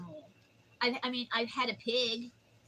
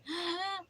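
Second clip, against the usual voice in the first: shocked gasp; 8 semitones higher than usual. Retold or imitated. Pig sitting in the pan.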